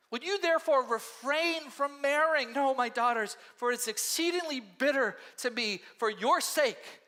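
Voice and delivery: a widow begging them to turn back; pleading Naomi. Acted. Naomi begs her daughters-in-law to go home, pleading.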